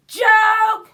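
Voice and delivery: loud, high shout of joke; tree shouting a taunt; cried out. Acted. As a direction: call it out sharply to mock. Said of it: "Another cried".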